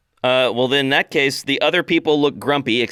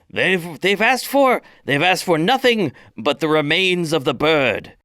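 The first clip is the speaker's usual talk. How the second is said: a touch of orc priestess; 6 semitones higher than usual. Acted. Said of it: "And Zumhug explains".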